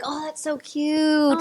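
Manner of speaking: high, slow, drawn-out mock coo; sugary, stretched-out admiration, put on; gushing imitation. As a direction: imitate people cooing over something adorable. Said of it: "they're always like".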